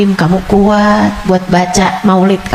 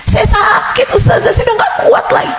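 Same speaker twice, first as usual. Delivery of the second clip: much higher, shouted plea; desperate congregant; 14 semitones higher than usual. Retold or imitated. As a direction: cry out at your limit. Congregant complaining. (jamaah mengeluh ke ustazah, berteriak putus asa)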